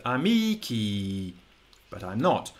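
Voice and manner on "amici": amici very slow, stressed mee, long kee tapering off; long unstressed final vowel. Shown as a demonstration. Teacher showing the stress is not on the end, long final vowel. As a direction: stretch both i's in amici, stress on the middle. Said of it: "accent and the stress on the end".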